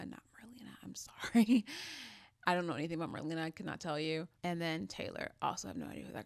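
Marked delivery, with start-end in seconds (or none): 0.1-1.7 quick whispered sorry; a sheepish whispered apology; whispered aside. Real, not acted. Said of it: "self-correction aside". 1.7-2.2 soft laugh. Real, not acted. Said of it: "laugh after correction".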